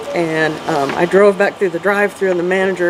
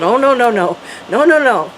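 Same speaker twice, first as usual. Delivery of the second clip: higher-pitched run of alarmed no's; retold dismay; 5 semitones higher than usual. Telling the host how she reacted to the girl's name, dismayed. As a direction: protest in dismay, voice lifting.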